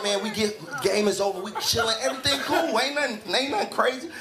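normal talking voice

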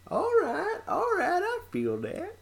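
squeaky high falsetto voice. Pretend.

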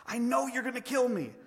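high cry of dread; frightened ghost. Acted. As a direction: accuse in a high, fearful voice. Ghost fears the angel.

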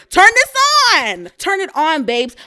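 high, loud squeal of turn this on; squealing urge. Real, not acted. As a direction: coax the brain in a shrill squeak. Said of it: "I'm slapping my brain".

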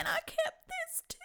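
very high tearful squeak; tearful quote. Retold or imitated. Fan quotes grandmother's keepsake line, tearful. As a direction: squeak the quote out through tears. Overcome quoting moving line.

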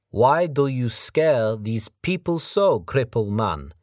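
put-on slow, high, loud taunting accent; menacing foreign thug. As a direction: sneer it like a big brute. Mongolian Sergei taunting.

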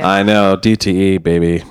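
low, slow crooner's swagger. Acted. Host slipping into a singer's lingo about venue names, bad crooner impression. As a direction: drop low and drag it like a lounge singer. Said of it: "my terrible [name] impression".

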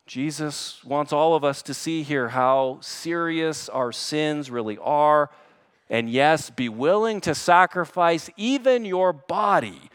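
loud, high, urgent pulpit exhortation; forceful preaching. Real, not acted. Preacher urging the church to fight sin, forceful.

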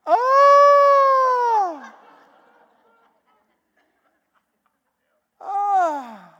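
high, loud cry of amazement; amazed cry. Real, not acted. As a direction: cry out in wonder. Forty-seven students show up.

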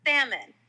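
"salmon" with the A of ant, silent L. Shown as a demonstration. Correcting a friend's attempt, salmon with A as in ant. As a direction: stress the first syllable of "salmon", A like ant. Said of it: "the A sounds like ant".

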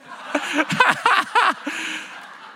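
high, helpless laughing fit; real burst of laughter. Real, not acted. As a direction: crack up at your own story. Cracks himself up mid-story.

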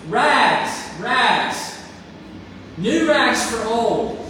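put-on loud vendor call, slow on new rags for old; sobbing ragman still calling his trade, loud and slow. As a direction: call it out down the street again. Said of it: "the sobbing ragman".

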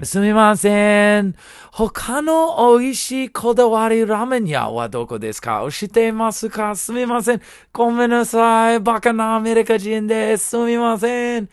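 bow your voice, apologising tourist asking directions; polite timid foreigner. Retold or imitated. Clueless American begging locals for ramen directions, high and apologetic.

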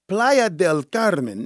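put-on slow, rolled Spanish accent on Playa del Carmen. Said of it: "my Spanish accent".

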